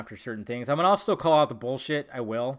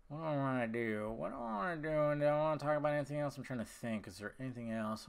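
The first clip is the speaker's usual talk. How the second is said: soft musing under your breath; absent-minded, quiet self-talk; thinking aloud; 9 dB quieter, faster than usual. Real, not acted. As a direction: talk quietly to yourself while searching for a topic. Thinking aloud what to discuss next.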